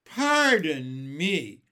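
ordinary delivery, nothing marked

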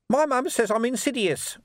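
very high, cheeky little voice; grinning urchin. A narrator's reading. Urchin answering how he got here, grinning.

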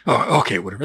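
ordinary talk

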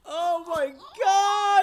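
high, loud drawn-out cry; theatrical horrified shout; mock horror. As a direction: stretch it into a hammed-up wail. Mock horror at the demon voicemail.

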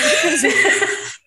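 squeaky held-in laugh; stifled giggle. Real, not acted. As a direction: laugh escaping despite trying. Guest's stifled laugh spills out.

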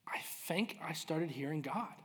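slow, soft near-whisper; retold in a careful hush; hushed confession. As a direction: confide it slowly, almost whispering. Voicing son's hushed confession.

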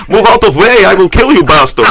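put-on Russian accent. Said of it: "as if I'm driving through Russia".

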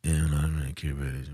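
low, soft, rushed mumbling; mock-calm mumble. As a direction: mumble it almost beyond comprehension, mocking an actor. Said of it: "Have you ever played calm that is this?".

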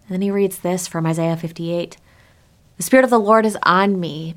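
ordinary talk, nothing marked

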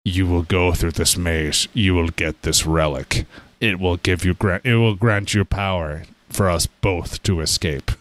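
lightly voiced imprisoned Mayan statue, low; a hint of a solemn, low-pitched captive sphinx; slow statue voice. Chained statue addressing the player, lightly acted low voice. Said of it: "he talks to you in a very slow, mumble voice".

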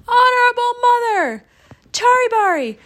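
high, loud, broken bursts; gasping. A narrator's reading. Chang muddles name to mother, gasping. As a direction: gasp out fragments between breaths. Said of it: "he gasped".

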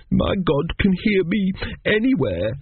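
put-on high, slow, devout little boy; fervent. A narrator's reading. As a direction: declare it with a child's earnest faith. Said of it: "said Arthur fervently".

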